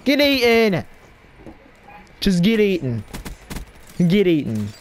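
high gloating get eaten; gloating taunt. Real, not acted. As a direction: rub it in after the kill. Taunting after a kill.

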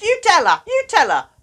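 the emotions pushing frustrated to the front, high and loud; pushy feelings. Pretend. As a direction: shove the job onto frustrated.

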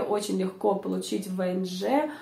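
normal talking voice

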